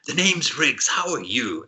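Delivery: high, loud jovial giant; big machine introducing himself; cheery warforged. Acted. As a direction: boom out a friendly greeting, pitched high. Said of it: "bursts out laughing".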